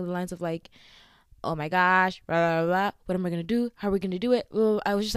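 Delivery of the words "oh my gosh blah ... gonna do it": louder, panicky ranting retold; mock ranting. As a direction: replay the worried rant, louder. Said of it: "like, ranting".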